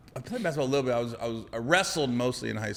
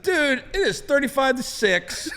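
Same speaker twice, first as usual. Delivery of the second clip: higher, louder, slower with a laugh; pleading player; 11 semitones higher, 4 dB louder, slower than usual. Retold or imitated. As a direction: argue the hopeless score, half laughing. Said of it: "I said".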